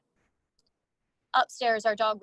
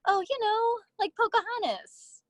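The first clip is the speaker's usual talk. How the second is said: put-on high sing-song girly voice; sweet, high sing-song teacher; 13 semitones higher than usual. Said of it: "in that kind of sing-song girly voice".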